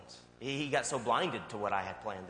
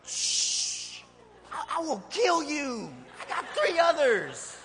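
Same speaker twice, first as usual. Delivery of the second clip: mock exasperated parent; 9 dB louder, much slower than usual. Mock threat to screaming kid.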